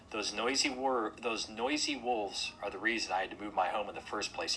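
plain reading voice

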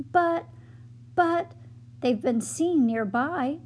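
stammering. Acted. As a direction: stumble over the first words. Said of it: "stammered Giles".

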